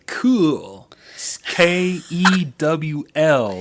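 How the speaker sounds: "cool" then "K E W L" slow and deliberate; spelling it out. Shown as a demonstration. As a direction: spell "K E W L" letter by letter, slowly. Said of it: "in case you wanna know how it's spelled".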